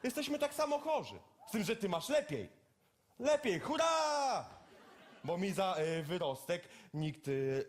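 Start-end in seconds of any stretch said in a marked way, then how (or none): 3.2-4.4 mock cheer.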